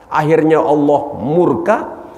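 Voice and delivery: normal talking voice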